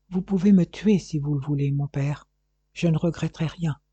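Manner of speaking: ordinary delivery, nothing marked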